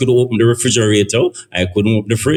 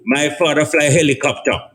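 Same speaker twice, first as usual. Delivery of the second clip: ordinary talk, nothing marked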